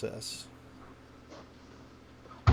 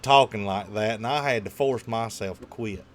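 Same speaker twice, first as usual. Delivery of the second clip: faked Southern accent; 8 dB louder than usual. Shown as a demonstration. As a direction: put on a co-host's country accent, loud and playful. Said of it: "I can fake your accent pretty good".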